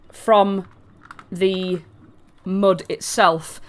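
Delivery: ordinary talk, nothing marked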